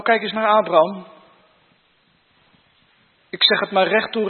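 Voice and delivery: ordinary talk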